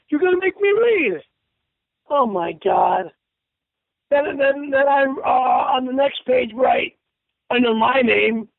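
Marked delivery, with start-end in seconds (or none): none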